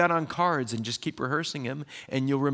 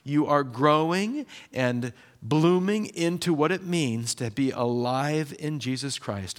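ordinary talk, nothing marked

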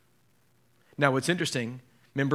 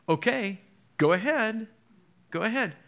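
obliging consent; 7 semitones higher than usual. Acted. Voices Jesus answering doubting Thomas.

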